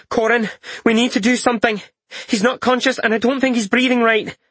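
high, urgent frightened voice; panicked young woman. Acted. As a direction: plead for help, voice pitched high.

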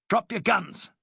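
sharp commanding shout. Acted. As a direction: bark the order at them. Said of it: "he shouted".

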